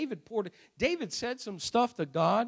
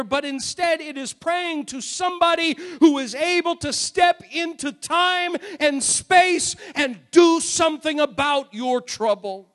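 higher, louder building sermon; impassioned preaching; 5 semitones higher, 4 dB louder than usual. Acted. Preacher declaring God able to act on trouble, impassioned preaching. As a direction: build with stretched emphatic words.

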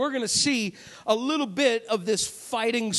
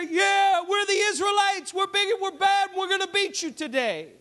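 taunting soldier; 9 semitones higher than usual. Retold or imitated. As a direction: brag at the enemy across the valley. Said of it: "they're going out there".

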